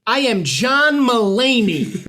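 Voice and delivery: high, slow, loud put-on voice; Sarah Silverman impression. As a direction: stretch it out in a silly celebrity voice. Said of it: "my Sarah Silverman impression".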